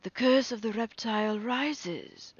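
high, ringing proclamation; chieftain's cry. A narrator's reading. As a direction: cry it out to rally the hunters. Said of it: "cried the chieftain".

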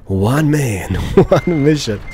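epic line cracking up; trailer voice breaking into laughter. Pretend. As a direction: try the trailer voice but laugh through it.

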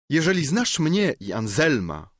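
exclaimed. A narrator's reading. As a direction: fling the line out in indignation. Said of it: "wykrzyknęła Kamilla". (wykrzyknięte z oburzeniem, wyżej i głośniej)

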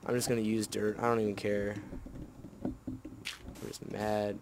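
ordinary talk

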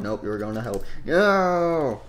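normal talking voice

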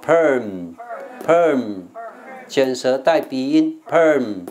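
long, slow "perm" with r-colour and hummed m; curled-tongue nasal ending. Shown as a demonstration. (perm 拉长，卷舌带鼻音)

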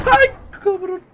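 high startled yell; real fright, then refusing; scared shout. Real, not acted. Jump scare.

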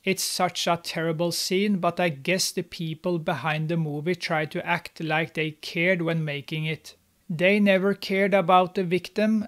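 ordinary talk, nothing marked

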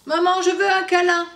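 put-on a child asking sweetly for a cuddle; little boy asking. Tchoupi asking maman for a hug, pleading.

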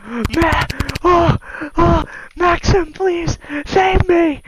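high, desperate cries for rescue; panicked pleading. Real, not acted. Player begging Maxim to save them, panicked high pleading.

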